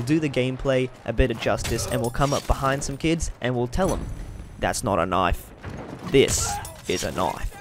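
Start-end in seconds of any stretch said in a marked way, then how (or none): 4.6-7.6 put-on broad Australian accent. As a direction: growl it like a Crocodile Dundee Aussie. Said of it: "in my strongest Australian accent".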